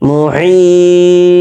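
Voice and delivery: "মুহিত" with a drawn-out, chanted ee and a short bouncing final t; long stretched vowel. Shown as a demonstration. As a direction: hold the "হি" of "মুহিত" very long, high and steady, then release "ত". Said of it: "বিশাল টান দেবো একটা".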